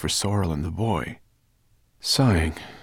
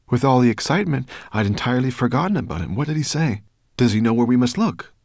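high, quick, eager questions; excited cry; 7 semitones higher, 6 dB louder, faster than usual. A narrator's reading. The dragon remembering and asking Sorrel, quick excited voice. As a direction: rush out eager questions, raised voice. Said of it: "cried Firedrake".

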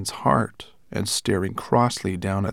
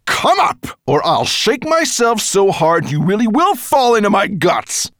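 angry dragon; 13 semitones higher, 10 dB louder than usual. Acted. Nettlebrand threatening.

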